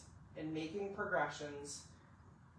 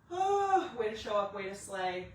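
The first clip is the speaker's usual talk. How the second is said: excited; 3 semitones higher, 6 dB louder than usual. Real, not acted. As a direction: cheer them on. Celebrating end of workout.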